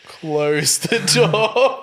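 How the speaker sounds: slow drawn-out order. Acted. Player voices character at door.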